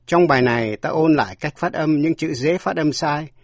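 normal talking voice

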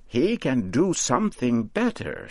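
put-on high cackly old woman, pleased with her work; high-pitched storybook witch admiring her scarecrow. A narrator's reading. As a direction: crow over your creation like a proud witch. Voices Mother Rigby.

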